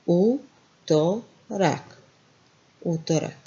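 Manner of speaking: Utorak spoken very slowly, each syllable apart; slow it right down, U-to-rak. Shown as a demonstration. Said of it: "Then what we have here?".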